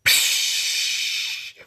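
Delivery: long hissed "psh" of a door opening; bus door hiss. Acted. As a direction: imitate a bus's air door releasing. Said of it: "The door opens up".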